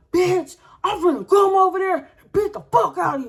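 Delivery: high, loud furious shout; screaming. Retold or imitated. Mother threatening the other woman by phone, screaming. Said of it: "I'm literally screaming like".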